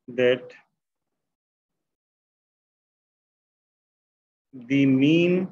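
halting word-by-word dictation, long gaps; slow dictation. Real, not acted. Teacher writing the conclusion for the class, slow dictation. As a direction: dictate it slowly while writing each word.

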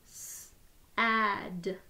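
each sound said separately with a short break; sounds tapped apart. Shown as a demonstration. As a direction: split the word into its sounds, one by one. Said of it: "Let's do it again".

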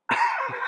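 short squeal of laughter, way up high; a burst of delight at the punchline; sharp high laugh. Real, not acted. After kid's answer "Halo.

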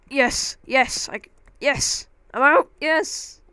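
high, loud triumphant yes; excited cheering. Real, not acted. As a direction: shout with joy at getting out. Escaping the hole, triumphant.

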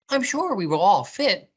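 lightly voiced high rabbit; storybook rabbit. A narrator's reading. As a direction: reassure friends as a little rabbit.

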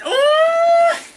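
very high excited squeal; delighted squeal. Real, not acted. Seeing the gift at last, delighted squeal. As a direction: squeal on a long "ooh".

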